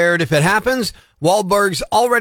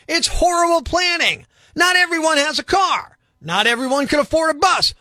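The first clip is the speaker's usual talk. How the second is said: indignant rant; 9 semitones higher than usual. Real, not acted. Ranting about town planning.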